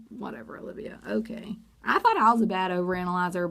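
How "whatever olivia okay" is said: whispered dismissive aside; mocking whisper. Real, not acted. Vlogger whispering a catty dismissal of Olivia.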